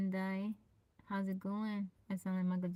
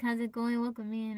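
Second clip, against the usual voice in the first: ordinary delivery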